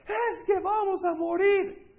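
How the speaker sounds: high, slow, loud panicked whine; whining crowd. Acted. Frightened Israelite complaining to Moses, high panicked whine. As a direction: wail it like a scared complainer. Grumbling Israelites voiced in sermon.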